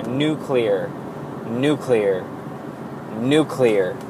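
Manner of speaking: "nuclear" split as "NU" plus "CLEAR"; correct pronunciation. Shown as a demonstration. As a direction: say "nuclear" as "new" then "clear". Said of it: "pronounce it like N U hyphen C L E A R".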